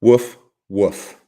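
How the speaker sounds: two loud, separate woofs; human dog imitation. Shown as a demonstration. Streamer asking chat about dogs, mock woof-woof. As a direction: bark it as plain loud words. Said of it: "ever heard a dog go".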